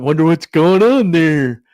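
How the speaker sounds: mock rubberneckers. People driving by in traffic, mock gawking. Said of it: "it's just people going".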